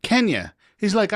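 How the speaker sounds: usual speaking voice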